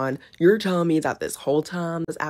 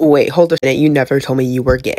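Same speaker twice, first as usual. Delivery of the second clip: loud shocked outburst; 8 dB louder than usual. Pretend.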